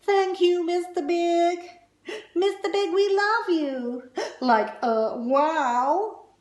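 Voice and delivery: high, loud gushing fans; loud high fan squeal; adoring fans. Acted. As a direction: swoon over him. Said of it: "This one says".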